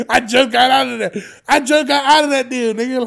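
loud, high, laughing exclamation; laughing protest. Real, not acted. Host refusing to shovel for parents, laughing and loud. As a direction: crack up, then protest at full volume. Cracking up about parents' chores.

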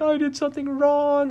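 put-on high, small upset kid voice; hammed-up wounded child; mock crying child. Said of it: "he's just crying".

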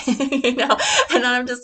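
laughing loudly through the words; a burst of real laughter. Real, not acted. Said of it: "laughs after quote".

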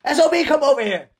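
playful call to a pet across the room; mock calling the dog.

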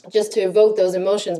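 ordinary talk, nothing marked

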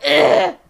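loud retold groan of disgust; disgusted "ugh". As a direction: blurt a big groan of revulsion. Retold disgust at seeing old enemy.

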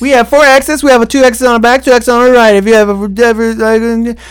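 high, loud jumbled safety spiel; hammed-up cabin announcement; flight attendant voice.

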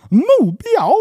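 put-on high, loud teenage 目标 with wild tones; sulky teen asking back, tones all over; teenager voice. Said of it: "You sound like a teenager".

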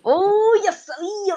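high laughing groan; surprised laughing cry. Real, not acted. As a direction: gasp then laugh it out. Reacts to hard trivia choice.

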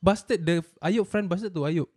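usual speaking voice

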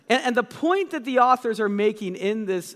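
ordinary delivery

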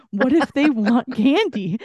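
mock husband whine. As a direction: ham up the husband's worried plea, drawn out. Husband's worried plea retold.

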